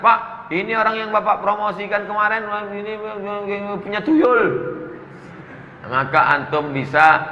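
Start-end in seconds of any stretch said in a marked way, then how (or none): 0.0-4.4 higher, sneaky tattletale voice; sly slanderer. Acted. Envious coworker slandering a colleague to the boss, higher and sneaky. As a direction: whisper poison to the boss, higher.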